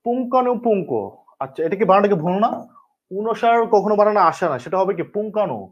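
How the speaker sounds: ordinary talk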